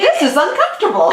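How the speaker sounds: high, loud laughing quote; laughing mock line. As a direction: squeal it out while laughing. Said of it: "it's still kinda like".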